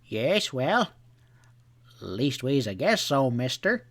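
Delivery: put-on an old clerk sputtering through loose false teeth. A narrator's reading. Old hotel clerk sputters at the dandy, lightly voiced. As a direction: splutter it out, flustered. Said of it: "sputtered Shackleteeth".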